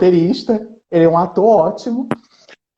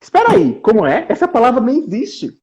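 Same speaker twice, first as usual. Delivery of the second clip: surprised narrator; 6 semitones higher than usual. Acted. A second narrator interrupting the reading, surprised. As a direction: jump in startled, like a second narrator. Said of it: "Entra aqui um outro narrador surpreso".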